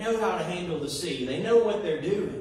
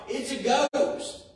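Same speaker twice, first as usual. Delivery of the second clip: higher, drawn-out mock cry; stretched-out, raised-pitch imitation of a frightened cry; retold cry of alarm; 7 semitones higher, slower than usual. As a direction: cry it out slowly, as if spotting a ghost. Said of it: "and they cry out".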